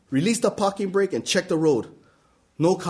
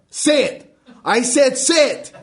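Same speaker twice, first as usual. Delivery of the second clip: louder, higher, barking orders; drill sergeant yell; 6 semitones higher, 4 dB louder, slower than usual. Retold or imitated. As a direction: yell like a military officer. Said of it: "yell again like some military officer".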